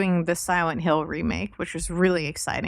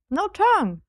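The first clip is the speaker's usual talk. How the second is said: cute anime streamer; 11 semitones higher, 3 dB louder than usual. Acted. Cute eating bit, marked voice.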